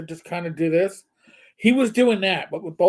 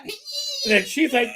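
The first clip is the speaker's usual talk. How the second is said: ordinary delivery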